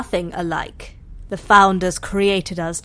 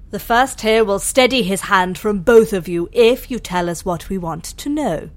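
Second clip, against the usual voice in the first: ordinary talk, nothing marked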